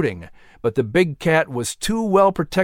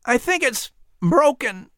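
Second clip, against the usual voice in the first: struggling to speak; 10 semitones higher than usual. A narrator's reading. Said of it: "Patrick struggled to say".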